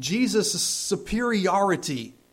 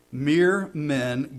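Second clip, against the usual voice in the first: normal talking voice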